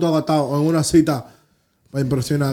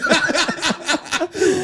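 high burst of laughter; 8 semitones higher, 4 dB quieter than usual. Real, not acted. Laughs after quoting. (carcajada aguda)

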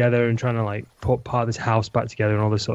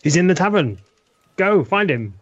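urgent order; 7 semitones higher, 3 dB louder than usual. Acted. Player urgently directs panicked villager.

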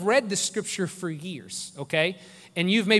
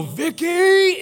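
loud, high booming yell; dad's booming voice; 11 semitones higher, 6 dB louder than usual. Retold or imitated. Dad calling his sensitive daughter, booming shout. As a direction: boom it out like an angry dad. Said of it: "he has this booming voice".

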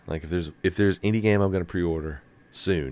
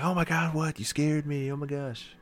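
high, startled squeal imitating a scared wife; high-pitched mock fright; 9 semitones higher, 4 dB quieter than usual. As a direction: play her jumpy and spooked, voice up high. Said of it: "she's like".

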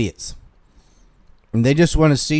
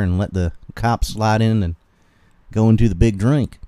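hammed-up Southern accent; 5 dB louder than usual. Streamer advising someone hunted by government agents, hammed-up Southern accent. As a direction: play up a country Southern twang. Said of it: "Read this with your thickest southern accent".

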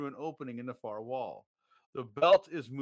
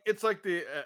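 ordinary talk, nothing marked

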